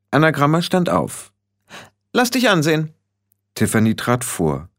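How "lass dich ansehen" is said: high-pitched imperious order; bossy young witch; commanding. Acted. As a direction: order a newcomer to step up for inspection. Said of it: "jene Art von Stimme, der man gehorchen musste".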